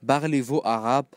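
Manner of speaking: usual speaking voice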